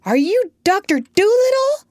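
high, loud shout with a rising end; shouted question. A narrator's reading. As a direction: call it out loudly over the rain. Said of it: "I shouted".

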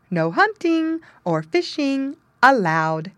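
slow, high sing-song reading. Acted. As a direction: chant the sign slowly, up high. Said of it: "in a sing-song tone".

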